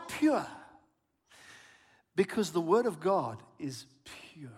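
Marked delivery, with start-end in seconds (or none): 2.2-4.5 soft whisper, much softer; whispered answer. Real, not acted. Pastor answering why pure, in a reverent whisper. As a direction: hush it like a sacred secret. Answers his own question softly.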